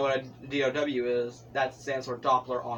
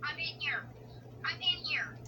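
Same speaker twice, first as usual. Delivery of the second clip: high, soft squeak; squeaky high voice; 13 semitones higher, 10 dB quieter than usual. Pretend. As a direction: squeak it like a scared mouse. Hiding from tornado, squeaky voice.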